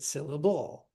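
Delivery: syl-la-BULL, weight thrown on the end; syllable said as sil-uh-BULL; exaggerated last-syllable stress. Shown as a demonstration. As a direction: wrongly punch the final BLE of syllable. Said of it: "try the different options and just exaggerate them".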